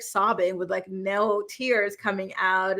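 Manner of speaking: ordinary delivery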